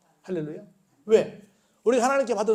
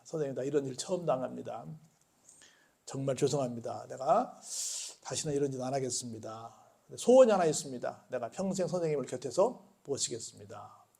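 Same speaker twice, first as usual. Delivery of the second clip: humble apology; 5 semitones lower, 7 dB quieter than usual. Acted. Kneeling robber apologises.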